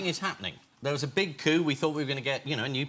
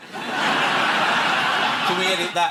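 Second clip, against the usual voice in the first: loud; 8 dB louder, much slower than usual. Real, not acted. Repeats request, comic emphasis.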